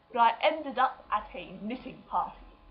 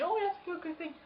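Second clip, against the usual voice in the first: put-on prim old lady; 8 semitones higher, 6 dB quieter than usual. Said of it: "and they were going".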